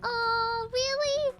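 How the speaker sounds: put-on high, eager little kid asking; excited kid voice. Streamer plays a thrilled little kid over sticker money. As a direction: play a child begging for stickers.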